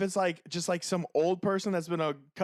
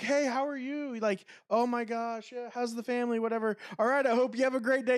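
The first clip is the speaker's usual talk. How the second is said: higher, chirpy customer greeting; all smiles; 7 semitones higher than usual. Retold or imitated. Imitating a coworker talking to his customer, all smiles. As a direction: imitate a smiling, friendly shop worker. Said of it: "He's, like, all smiling".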